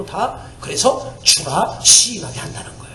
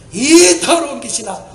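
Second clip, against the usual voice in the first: commanding rebuke; 5 semitones higher, 5 dB louder than usual. Retold or imitated. Rebuking the demo forcefully.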